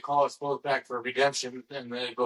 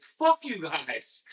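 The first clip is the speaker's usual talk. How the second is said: drawn-out fuck you; slow, exasperated curse at the producers; 3 semitones higher, much slower than usual. Retold or imitated. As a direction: stretch every word in disgust. Retold outburst, drawn out.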